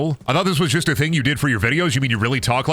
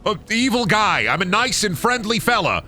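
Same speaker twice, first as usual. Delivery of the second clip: mock indignation; 9 semitones higher than usual. Protesting game pushing evil.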